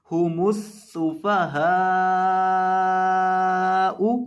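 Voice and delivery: सुफहाउ with the फा stretched three counts; slow recitation with a long फा. Shown as a demonstration. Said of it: "फा के ऊपर मद करनी है".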